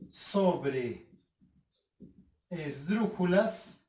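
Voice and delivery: word by word with long pauses; measured, spaced-out teacher delivery; slow dictation. Shown as a demonstration. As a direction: dictate it one piece at a time. Dictating the rule slowly.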